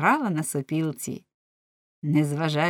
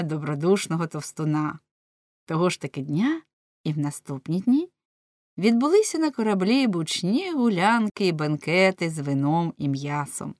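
ordinary delivery, nothing marked

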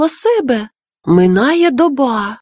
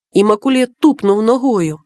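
ordinary delivery, nothing marked

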